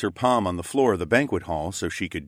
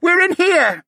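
high, loud muffled shout; loud, high cry for help; 10 dB louder, slower than usual. Acted. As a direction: yell for rescue from behind a door. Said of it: "the koala man shouted".

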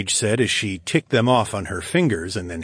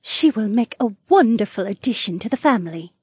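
put-on gentle older woman; mother voice; 13 semitones higher than usual.

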